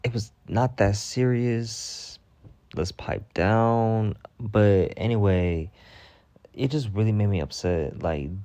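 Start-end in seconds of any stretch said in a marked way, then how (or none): 0.0-4.2 slow, drawn-out scolding; mock calm scolding. Slowly telling the commenter to pipe down. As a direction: ease him down, slowly and dryly. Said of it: "I'm like".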